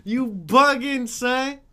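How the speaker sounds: high, loud mock disbelief; loud retold scolding. Telling Kermit he's bugging, loud exasperated scolding. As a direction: call him out at full volume.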